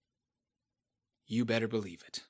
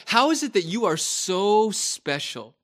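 usual speaking voice

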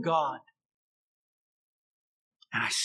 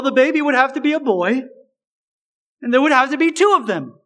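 rising higher and faster over the absurd list; exasperated sarcasm; 4 semitones higher than usual. Retold or imitated. Preacher mocking the impossible plan, exasperated. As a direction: pile on the absurdity, climbing. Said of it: "the utter ridiculousness of such a thing".

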